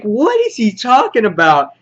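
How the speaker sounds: high, loud imitated confusion; mock bafflement. Negan's people reacting to his speech, mock bafflement. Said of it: "his people are just like".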